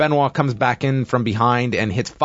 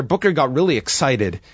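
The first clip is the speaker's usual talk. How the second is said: ordinary delivery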